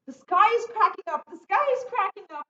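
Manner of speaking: high, loud child's cry, imitating a frightened son; high, loud voice of a frightened young boy; scared little boy. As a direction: squeal it like a scared little kid. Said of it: "what my son used to say".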